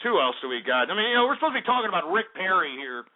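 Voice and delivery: ordinary talk, nothing marked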